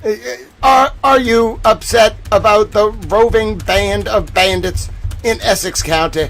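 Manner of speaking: usual speaking voice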